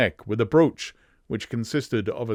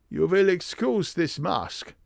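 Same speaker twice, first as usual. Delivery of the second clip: slow, high German apology; strong German accent; 7 semitones higher than usual. Acted. Strange visitor excusing his mask, slow German accent. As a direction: draw it out, heavily German. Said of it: "a strongly marked German accent".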